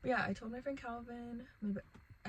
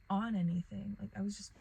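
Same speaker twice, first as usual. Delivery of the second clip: ordinary talk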